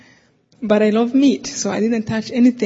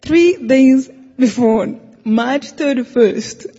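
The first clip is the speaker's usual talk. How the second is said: slow and louder, each word weighed; slow emphatic date; 3 semitones higher, 4 dB louder, slower than usual. Real, not acted. Telling a friend the date, slow and emphatic. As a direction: lay out the date slowly, for weight.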